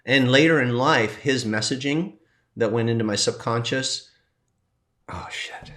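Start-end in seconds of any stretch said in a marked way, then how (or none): 5.1-5.7 whisper. Real, not acted. Startled aside, whispered.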